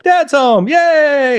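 put-on high, drawn-out child's cheer; imitated kids cheering. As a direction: cheer like kids greeting Dad. Imagined kids cheering dad's arrival.

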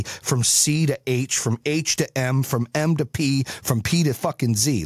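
each step spaced out slowly for weight; slow, deliberate. Real, not acted. Coach to podcast listeners, slow emphatic pacing. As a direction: lay out every step slowly. Spelling out the steps one by one.

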